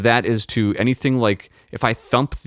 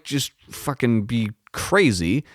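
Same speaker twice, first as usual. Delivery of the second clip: ordinary talk, nothing marked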